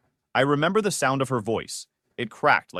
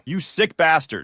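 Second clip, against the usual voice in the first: furious raised voice; yelling; 4 semitones higher, 5 dB louder than usual. A narrator's reading. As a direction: yell it at him. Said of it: "he yelled".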